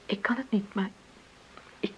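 whispered confession; broken hushed admission. Acted. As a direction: breathe it out, near tears. Confession of unhappiness.

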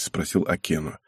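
ordinary delivery, nothing marked

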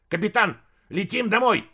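high, loud command yelled; loud shout. Acted. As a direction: bellow the order. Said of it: "услышав громкое".

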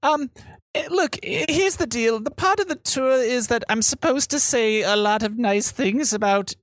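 high, halting guide voice; fed-up tour guide. Acted. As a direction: reluctantly get through the official speech.

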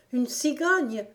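surprised exclamation. A narrator's reading. Gon echoes the servant's news of a stork, surprised exclamation. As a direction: cry it back as an astonished little girl. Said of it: "s'écria Gon".

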